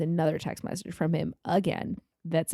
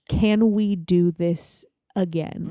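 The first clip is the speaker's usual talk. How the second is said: very slow, measured question; deliberate word-for-word quote; much slower than usual. Retold or imitated. Quoting the date's parting question literally, slow and deliberate. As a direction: space out each word for emphasis.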